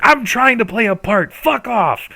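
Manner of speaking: high, loud retort; angry grandmother. Acted. Grandmother yelling back at her daughter, high and loud. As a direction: bite back at your daughter. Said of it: "absolutely screaming".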